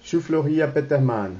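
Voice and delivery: usual speaking voice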